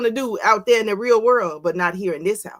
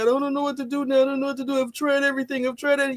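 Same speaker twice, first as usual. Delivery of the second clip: mock helpless parent; 3 semitones higher than usual.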